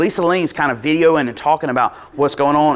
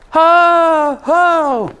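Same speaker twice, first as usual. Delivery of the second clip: high, loud ceremonial cheer; crowd cheer; 14 semitones higher, 5 dB louder than usual. Retold or imitated. The whole room hails the leader, loud high cheer. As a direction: shout it out like a whole room hailing. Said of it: "the entire room steps up".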